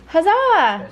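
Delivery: high, loud huzzah; excited cheer. Real, not acted. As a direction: cheer for the delivery. Excited at package.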